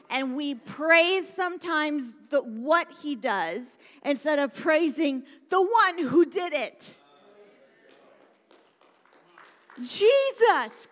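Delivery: loud, high, full-force proclamation; impassioned preaching. Real, not acted. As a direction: shout the praise out to the congregation.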